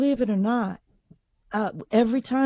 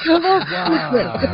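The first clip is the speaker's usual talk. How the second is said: laughing echo; 9 semitones higher, 5 dB louder than usual. Real, not acted. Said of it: "echoing the teacher, laughing".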